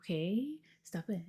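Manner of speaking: ordinary talk, nothing marked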